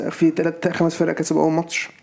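ordinary talk, nothing marked

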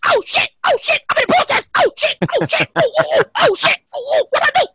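high, hammed-up panicked yelping; mock panic. Man realizes he is in a protest, mock high panic.

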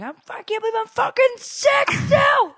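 high, loud mimicked outburst; mock freak-out. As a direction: imitate his meltdown at full pitch. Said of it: "Like, freaking out".

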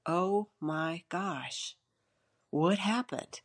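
slow, hushed gasp of shock; retold stunned murmur over the empty pages; stunned disbelief. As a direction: breathe it out as if the ink just vanished before you.

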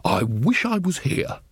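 put-on slow, dreamy, far-away old wizard; befuddled man chatting to furniture; dotty bursar. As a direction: vague and pleased with himself. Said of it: "said the bursar to a chair".

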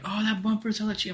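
high-pitched dismissive wife voice; imitated wife. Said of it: "She's like".

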